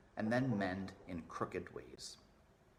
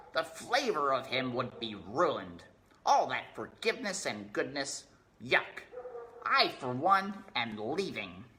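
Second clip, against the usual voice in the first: disgusted rat; 4 semitones higher, 5 dB louder than usual. Acted. Botticelli disgusted.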